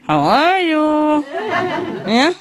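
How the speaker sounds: high squeaky puppet voice, slow and laughing; ventriloquist's puppet greeting the club; ventriloquist dummy voice. Acted. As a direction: squeak it out like a dummy on his knee. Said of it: "a ventriloquist".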